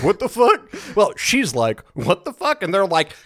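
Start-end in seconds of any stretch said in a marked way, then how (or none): 0.0-0.7 loud, high-pitched shocked question; startled disbelief. Retold or imitated. Players reacting to the sudden demon chant, startled. 1.9-2.6 bewildered at herself. Retold or imitated. Singer startled by her own chanting, bewildered.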